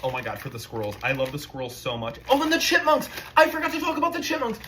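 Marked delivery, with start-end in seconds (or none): 2.3-4.6 high, loud and fast; excited. Real, not acted. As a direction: burst out with sudden excitement. Sudden excited remembering.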